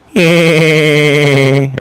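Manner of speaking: long, high cartoon squeal; Peter. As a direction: hold a high "eee" while running off. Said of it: "And you hear Peter".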